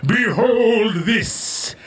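high, slow, booming proclamation; triumphant villain shout. Acted. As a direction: bellow it like a mad villain. Villain proclaiming the flaming dessert.

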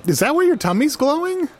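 surprised. Real, not acted. Amazed at glowing tummy.

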